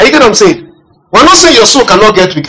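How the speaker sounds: ordinary talk, nothing marked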